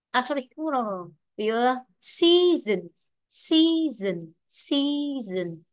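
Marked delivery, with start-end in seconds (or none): 2.0-5.6 "season" with a long, stressed first syllable; teacher drilling "season" as SEA-son. Shown as a demonstration. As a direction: pitch up and stretch the "sea" in "season". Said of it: "thì trọng âm rơi vào si".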